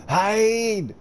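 high, loud urgent cry; alarm shout. Real, not acted. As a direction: shout a warning. Urgent call to hide.

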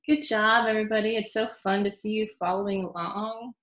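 ordinary talk

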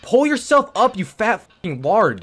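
high, loud insulting yell; furious yelling at a game; angry shout. Real, not acted. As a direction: bark it at the useless climber. Raging at game character.

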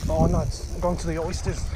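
ordinary delivery

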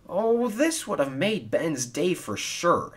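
high, mildly glum lament; wistful, lightly acted glumness. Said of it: "he said glumly".

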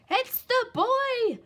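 high, loud, drawn-out alarm; excited cry. A narrator's reading. Little Wolf alerts his parents, excited high cry. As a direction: cry it out like big news. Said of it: "he cried".

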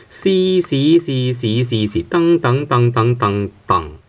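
"C" then "dang" stepping down from highest to lowest pitch; walk each syllable down the tone ladder. Shown as a demonstration. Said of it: "ranking from the highest pitch to the lowest pitch".

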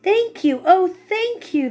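high, gushing thank you; grateful cry. Acted. Witch thanking her rescuers, high and gushing. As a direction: gush your thanks up high. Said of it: "the grateful witch cried".

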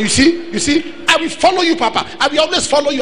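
high, eager voice of a follower; imitated excited devotee; eager pledge. Man eagerly pledging to follow Papa.

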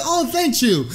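high, sweetened reply; mock flattered thanks. Patient thanking nurse for compliment, high mock-flattered voice.

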